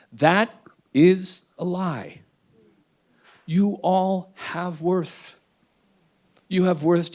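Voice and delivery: slow, deliberate and sincere; slow earnest you all have worth; slow heartfelt reassurance. Real, not acted. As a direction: tell them they matter, slowly. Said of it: "emphatic reassurance to listeners".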